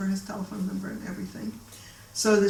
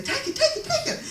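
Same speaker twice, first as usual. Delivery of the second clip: very high, urgent squeal; Norma, frantically eager. Retold or imitated. Client urging negotiator to accept more money, mock frantic. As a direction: push to accept in rapid, squeaky repeats.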